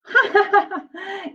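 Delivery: put-on high little-girl giggle; giggling laugh. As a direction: giggle like a small girl crunching cereal. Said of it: "Anna laughed".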